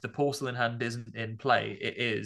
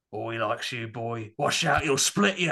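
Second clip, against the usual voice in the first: put-on lightly gruff Australian country accent, louder at the end; 4 dB louder than usual. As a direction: imitate the possessed girl's rough country accent. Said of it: "like, a country accent".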